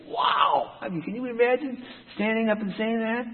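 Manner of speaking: high-pitched, airy wow of disbelief; amazed. Real, not acted. Preacher marvels at the prophet standing up to kings, amazed.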